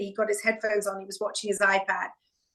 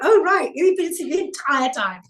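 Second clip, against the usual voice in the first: laughing stewardess; 6 semitones higher, 5 dB louder than usual. Retold or imitated. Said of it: "And she laughed".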